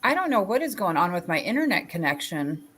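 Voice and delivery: ordinary talk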